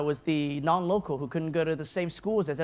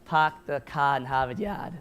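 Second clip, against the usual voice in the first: put-on classic Boston accent, dropped r's; mock Boston accent on the old Harvard Yard line; playful bad Boston accent; 3 semitones lower than usual. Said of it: "my terrible Boston accent".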